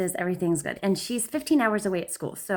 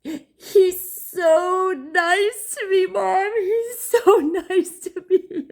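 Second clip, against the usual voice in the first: much higher, louder, very slow sob-laugh; imitating sobbing daughter; 11 semitones higher, 6 dB louder, much slower than usual. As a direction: wail it, half laughing half crying.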